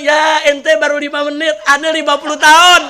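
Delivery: loud, high boastful comeback; husband shouts back at the jinn about his fifty years; shouted retort. Retold or imitated. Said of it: "dijawab ini orang".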